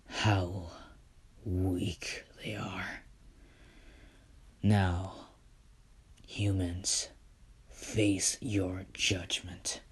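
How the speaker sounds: slow, hushed godlike threat; cold whispered verdict on the humans; slow menacing whisper. Acted. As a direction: pass judgment in a slow whisper.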